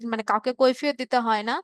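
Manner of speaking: ordinary talk, nothing marked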